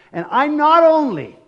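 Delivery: NOT only flung out high and loud; emphatic. Real, not acted. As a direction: hit "not" hard and high, like a preacher's cry. Preacher emphasizes point.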